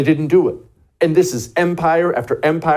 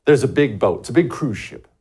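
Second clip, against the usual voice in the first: ordinary talk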